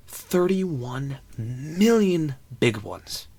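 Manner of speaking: big, slow storyteller's emphasis; hyped jackpot figure. Acted. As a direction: stretch out each word for impact. Storyteller hyping the lottery jackpot.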